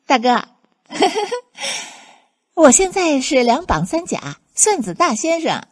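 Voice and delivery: high, gleeful bragging; bubbling with delight; overjoyed. Acted. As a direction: show off your success to a big brother. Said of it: "好发泄一下心里藏不住的欢喜".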